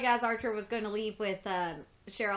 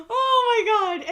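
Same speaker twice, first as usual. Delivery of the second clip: real laughter, high, loud and slow; breathless from laughing; 10 semitones higher, 9 dB louder, much slower than usual. Real, not acted. Vlogger recovering from a laughing fit, laughing. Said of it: "recovering from laughter".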